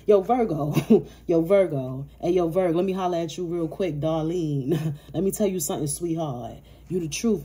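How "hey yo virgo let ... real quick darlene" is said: ordinary delivery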